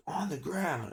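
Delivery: high mock cop yell; imitating officer's command. Cop ordering suspect down, high imitated yell. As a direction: mimic the cop's urgent order.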